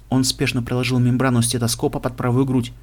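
ordinary delivery, nothing marked

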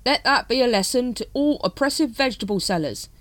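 lightly voiced triumphant cult brother; gloating zealot. A narrator's reading. Brother Plasterer gloating over vegetable sellers, triumphant.

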